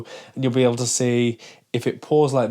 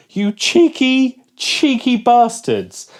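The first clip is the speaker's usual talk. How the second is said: high, slow, louder indignant cry; real outrage at the label; outraged; 9 semitones higher, 4 dB louder, slower than usual. Real, not acted. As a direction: let the betrayal burst out. Outraged at sugar claim.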